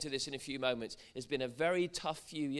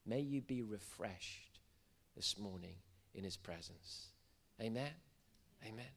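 prayerful blessing; 3 semitones lower, 5 dB quieter than usual. Acted. Preacher blessing the congregation to be refreshed, prayerful. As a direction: speak it gently over the room, unhurried. Quiet devotional blessing over the room.

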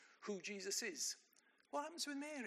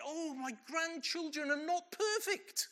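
higher, louder mock dismay at imperfect grandchildren; mock shocked gasp; 8 semitones higher, 7 dB louder than usual. Preacher mocks a shocked grandparent, louder, higher, with mock alarm. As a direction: play a grandparent pretending to be shocked. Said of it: "I'm not suddenly thinking".

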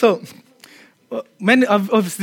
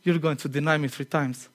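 quiet calm Jesus; 4 semitones lower, 8 dB quieter than usual. Acted. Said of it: "And Jesus is like".

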